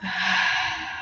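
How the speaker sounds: slow audible breath out through the mouth; long exhale. Real, not acted. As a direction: let the air go with a sigh. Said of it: "out of the mouth".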